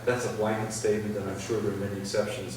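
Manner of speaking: ordinary talk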